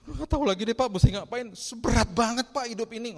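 high, loud wailing imitation; mock crying. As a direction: wail to the pastor in acted despair. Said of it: "Terus nangis".

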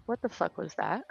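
ordinary talk

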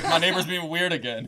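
laughing nosy neighbor on the phone. Pretend. Neighbor calling cops voiced.

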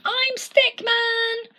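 high, loud, slow call of his name; joyful homecoming cry. Acted. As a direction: shout it home to the family.

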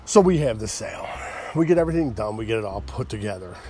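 ordinary talk, nothing marked

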